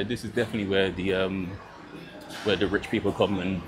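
ordinary delivery

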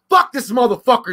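loud, high-pitched angry outburst; mad wife impression. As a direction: imitate an angry wife cursing. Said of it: "she gets all mad".

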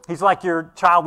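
ordinary talk

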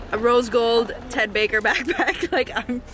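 usual speaking voice

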